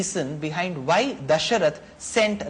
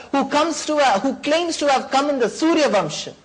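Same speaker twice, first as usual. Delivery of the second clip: higher and louder, scornful rebuke; furious sage; 6 semitones higher, 5 dB louder than usual. Acted. The sage angrily scolds Dasharath for his false promise, loud and higher. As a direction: lash out at the broken promise.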